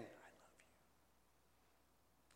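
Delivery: barely audible, tender whisper. Acted. Preacher voicing God's message to the congregation, whispered. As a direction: whisper it very softly, almost no voice. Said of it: "he's whispering to us".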